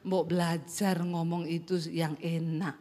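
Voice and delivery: low, soft husband voice; husband's advice. Retold or imitated. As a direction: imitate the husband's low, restrained Javanese. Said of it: "saya selalu dibilang".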